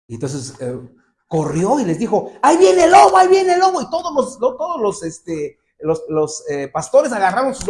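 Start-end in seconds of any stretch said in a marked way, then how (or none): 2.4-3.8 much higher, loud cry of alarm; boy crying wolf. Retold or imitated. Shepherd boy fooling the shepherds, high alarmed shout. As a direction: call out like a prankster boy sounding the alarm.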